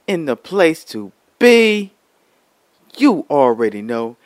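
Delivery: hype announcer. Acted. Hype show intro line.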